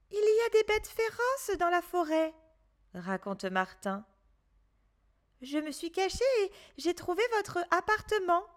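lightly voiced worried boy; little boy Martin. A narrator's reading. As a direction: tell it fast like a scared child. Martin voice in story.

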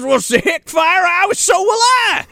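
hammed-up country shout; mock outburst. Retelling a character's heated outburst.

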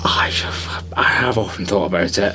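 hushed confessional whisper; sheepish whispered admission. Real, not acted. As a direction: whisper it like a guilty secret. Admits wanting the jacket.

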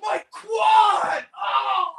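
high shouted mock agony; hammed-up wail of pain, loud; Kevin Nash. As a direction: imitate an injured wrestler crying out, very high. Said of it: "Can you imitate Kevin Nash?".

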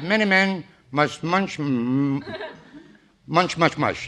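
"Many men must munch" slowly, leaning on each m; over-emphasized tongue twister. Shown as a demonstration. Boss correcting the announcer's test reading, exaggerated emphasis. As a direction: stretch the m before "munch, much, mush", mush-mouthed. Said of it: "you should have emphasized".

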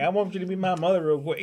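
quick put-on Southern accent; Southern accent, pitched a little high. Shown as a demonstration. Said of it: "like, a Southern accent".

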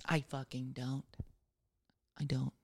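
a quiet, bitter confession; under breath. Real, not acted. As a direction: mutter it half to yourself. Muttered aside about the job.

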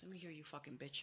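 very soft, low mutter; muttering to oneself. Retold or imitated. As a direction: mutter it under your breath, barely audible. Muttering to himself while listening in.

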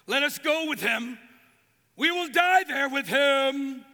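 loud, slow, bold vow; brave declaration. Retold or imitated. Thomas boldly telling the disciples he'll die with Jesus, slow and loud. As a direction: declare it like you'd die for him. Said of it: "one brave voice says".

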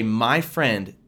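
usual speaking voice

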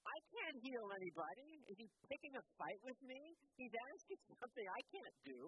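high, loud, panicked protest from the king; the king throws up his hands in alarm; upset king. Acted. King of Israel protesting the letter, upset and loud. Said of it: "the king of Israel gets really upset".

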